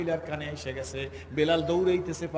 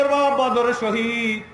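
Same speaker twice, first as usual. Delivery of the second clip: high, loud declaration; calling out; 8 semitones higher, 10 dB louder, slower than usual. Retold or imitated. Woman calling out that the father was martyred, high shout. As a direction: proclaim it at full voice. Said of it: "ডাক দিয়ে বলে".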